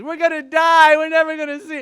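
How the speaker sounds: high, panicked cry of a terrified crewman; freaking out. Retold or imitated. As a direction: yelp it out like you think the boat is sinking.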